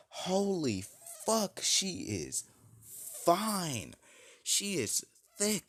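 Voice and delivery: high-pitched, drawn-out lusty awe; smitten, stretching each word high; awestruck inner exclamation. Acted. Naruto's awed thought behind straight face.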